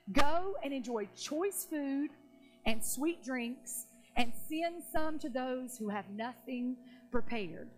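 slow, spaced-out scripture reading; measured, weighty delivery; deliberate proclamation. Retold or imitated. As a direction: proclaim each phrase slowly, with pauses. Solemn reading of Nehemiah's command.

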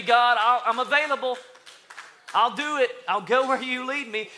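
building high and loud to full force; fervent preaching. Acted. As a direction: preach it as an eager volunteer's prayer. Voices eager believer at full force.

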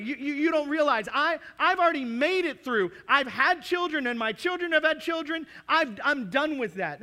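high, loud hammed-up old saint; mock indignant elder. Preacher playing an older member refusing to serve, hammed-up indignation. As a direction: huff that you're done with that.

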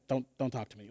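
low, quiet mock grumpy brush-off; retold mad. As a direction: grumble it under your breath, half-acted. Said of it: "I was so mad".

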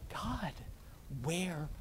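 soft, slow, hushed lament; quiet lament. Retold or imitated. Believer quietly lamenting to God, hushed and slow. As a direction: breathe the question out slowly.

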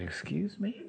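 high, rising disbelief; a puzzled, high-pitched query; taken aback. Retold or imitated. Receptionist taken aback in retold exchange.

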